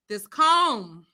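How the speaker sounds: ordinary delivery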